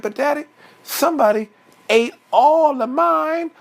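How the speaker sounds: high-pitched complaining little bear; Baby Bear. Acted. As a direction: plead with daddy in a high child voice. Baby Bear complains to his father.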